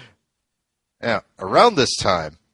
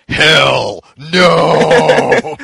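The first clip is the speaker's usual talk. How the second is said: sudden huge wrestler yell, drawn out; Hulk Hogan; 5 semitones higher, 11 dB louder than usual. Retold or imitated. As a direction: imitate a defiant roar at full volume. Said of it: "suddenly yells out".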